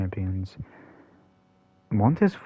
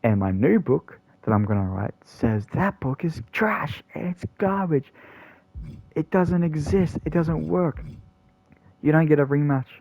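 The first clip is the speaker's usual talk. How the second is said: a higher, mocking voice for the new champ; cocky champion; 6 semitones higher than usual. L A Knight taunting Montez Ford about the rematch, high and mocking. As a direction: lord it over him with smug glee. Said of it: "And then L A Knight's".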